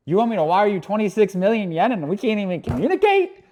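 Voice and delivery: can't believe what he's being asked; incredulous. Real, not acted. To viewers about wiring money, rising disbelief.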